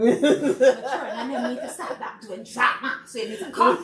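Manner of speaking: laughing while quoting the request. Real, not acted. Guest laughing as she retells Miss Christine's demand. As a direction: chuckle through the quote.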